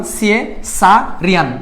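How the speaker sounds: slow, syllable by syllable; broken into syllables. Shown as a demonstration. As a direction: split the word into slow chunks. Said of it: "তিনটা ভাগে ভাগ করে ফেললাম". (ধীরে ভেঙে ভেঙে উচ্চারণ)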